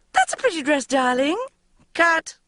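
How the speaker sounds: high, sing-song passing remark; breezy mother. A narrator's reading. Mother rushing past her daughter, high and breezy. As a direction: toss it off while rushing past.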